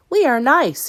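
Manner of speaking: put-on small boy's voice, high and eager; young Caleb. A narrator's reading. Caleb asking his sister, high child voice.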